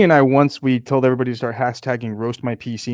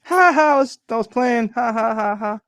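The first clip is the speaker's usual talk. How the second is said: mock gloating laugh; 10 semitones higher, 4 dB louder, slower than usual. Mock gloating after kicking GPU.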